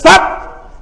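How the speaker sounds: sudden stop. Real, not acted. Cuts off reader mid-verse.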